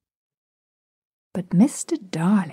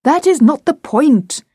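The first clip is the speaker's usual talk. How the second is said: higher, louder, emphatic objection; indignant retort; 7 semitones higher, 5 dB louder than usual. A narrator's reading. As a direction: snap back at the children. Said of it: "he retorted".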